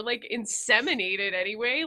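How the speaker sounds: normal talking voice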